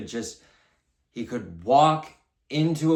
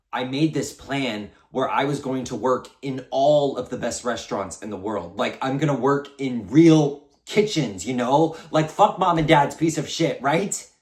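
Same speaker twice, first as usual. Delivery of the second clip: loud, higher-pitched rush of defiance; worked-up defiance; 4 semitones higher, 9 dB louder than usual. Acted. Brother recalls his plan to prove himself, worked-up and defiant. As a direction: build to a loud, defiant rant.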